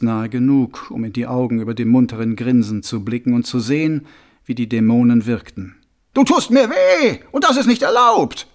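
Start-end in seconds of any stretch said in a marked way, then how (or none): 6.1-8.5 whiny villain. A narrator's reading. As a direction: play the wounded innocent. Karzer whines.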